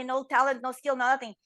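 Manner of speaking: ordinary talk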